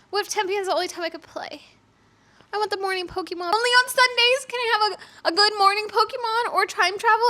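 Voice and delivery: high, loud exasperated whine; whiny protest. Acted. Player protesting she'd miss morning Pokemon, whiny exasperation. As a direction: build from fretting to a high, loud complaint. Mock distress over real-time game limits.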